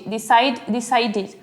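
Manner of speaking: "decided" with a sounded "id" ending; bare "Decide", then "decided" with "id" added; extra "id" syllable. Shown as a demonstration. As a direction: say "decided" in three beats, de-cide-id. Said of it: "agregar la pronunciación id".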